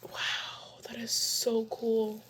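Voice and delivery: soft, slow whispered awe. Real, not acted. Marveling to herself at her curls, hushed whisper. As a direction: breathe it out in wonder.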